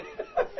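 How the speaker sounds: a burst of high delighted laughter; high giddy laugh. Real, not acted. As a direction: laugh from pure joy. Joyful laugh in retelling.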